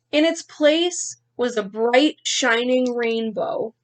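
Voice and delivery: lively picture-book reading; story narration. Acted. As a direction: read the ending to kids. Teacher finishes reading aloud.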